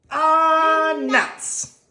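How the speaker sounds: high squeaky sigh; squirrel groan. Acted. Squirrel at the birthday party, high groan.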